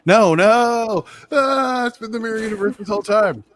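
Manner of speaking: high, loud sudden realization; surprised realization. Real, not acted. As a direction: gasp into the realization, fast.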